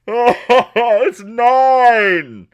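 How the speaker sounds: high, loud dumb ogre shout; ogre cheering. Acted. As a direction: bellow it like a happy ogre. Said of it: "you hear".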